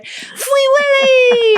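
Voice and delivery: put-on high, slow toddler squeal; high drawn-out child's cheer; gleeful child. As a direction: squeal it on the way down the slide. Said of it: "kept going down the slide going".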